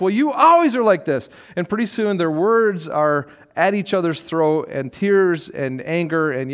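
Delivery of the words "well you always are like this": high, louder accusing comeback; acted-out marital spat, voice raised; mock angry retort. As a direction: fire back like a hurt wife. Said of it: "so she responds back".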